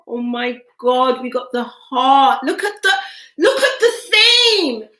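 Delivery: high, loud and laughing with delight; thrilled squeal over the heart card; excited. Real, not acted. Thrilled at drawing the heart card, excited laughing.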